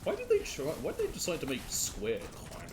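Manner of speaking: high, exasperated question; baffled why-would-they; incredulous. Real, not acted. Frustrated at game controls.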